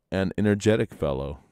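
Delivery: usual speaking voice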